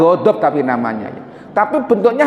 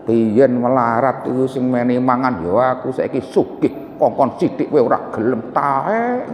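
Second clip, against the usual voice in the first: a lower, softer mutter of resentment; inner grumbling; 7 semitones lower, 4 dB quieter than usual. Retold or imitated. Preacher voicing a resentful person's inner grumble, lower and softer. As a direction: grumble it under your breath like a bitter ex-friend. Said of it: "Ini namanya ghilun".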